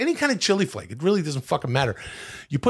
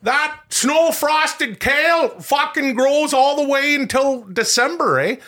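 hammed-up Canadian accent, much higher, ending on eh; 12 semitones higher, 4 dB louder, slower than usual. Host describes snow-frosted kale as a Canadian, high hammed-up accent. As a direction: play a loud, excited Canadian hoser. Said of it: "Canadian beans and kale".

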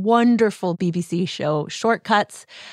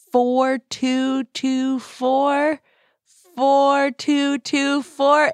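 very slow, higher-pitched chant of digits; slow, deliberate announcement, pitched up; drawn-out reveal; 6 semitones higher, much slower than usual. Real, not acted. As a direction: stretch out each number for suspense. Revealing the palindrome date with fanfare.

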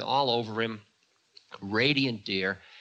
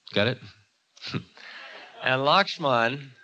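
ordinary delivery

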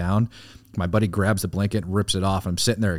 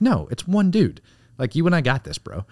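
cocky reassurance; 9 semitones higher, 4 dB louder than usual. Retold or imitated. Retold reply to teammate.

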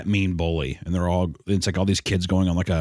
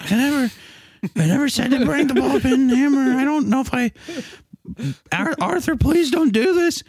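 much higher, pleading cartoon kid; high frightened Buster. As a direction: beg Arthur in a squeaky panic. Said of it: "And Buster's like".

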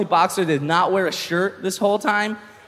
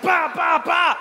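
high shouted "bah" sound effects; imitated punches; 10 semitones higher, slower than usual. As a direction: mouth each blow in a high burst. Imitating his own wild axe-like punches.

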